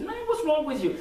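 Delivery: high-pitched, indignant challenge, acted out; mock confrontation. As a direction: play someone squaring up after a bump. Acted shoulder-bump confrontation.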